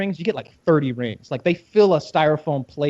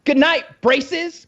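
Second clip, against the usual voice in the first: taunting; 11 semitones higher, 3 dB louder, much slower than usual. Retold or imitated. Parting insult.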